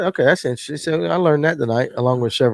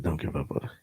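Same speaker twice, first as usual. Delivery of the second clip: much lower, much softer sexy voice; teasing mock sultry whisper-low; 11 semitones lower, 15 dB quieter than usual. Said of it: "like in a sexy voice".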